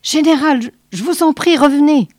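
doctor begs the general to return; frightened pleading. Acted. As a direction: beg them to come back, scared. Basset begs under arrow volley.

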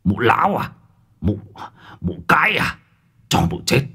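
put-on strained, seething old bully, in short bursts; hissed curse. A narrator's reading. Village official cursing the old woman he kicks, seething. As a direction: spit out each curse through clenched anger. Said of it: "Miệng của lão rít lên".

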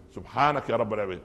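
usual speaking voice

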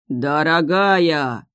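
slow drawn-out sea king roar. Acted. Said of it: "раздался рык морского царя".

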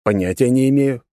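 mocking echo of the line; teasing. A narrator's reading. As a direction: needle her with it. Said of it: "не сдержался от подколки".

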